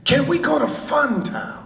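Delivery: put-on very high, pleading seven-year-old girl; Yolanda King. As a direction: squeal it like a little child begging Daddy. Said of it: "she, being seven years old".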